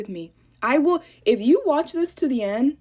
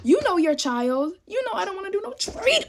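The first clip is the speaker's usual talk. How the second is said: exasperated; 8 semitones higher than usual. Real, not acted. Talking about her mother who should know her, exasperated high-pitched protest. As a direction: pitch jumps way higher in disbelief.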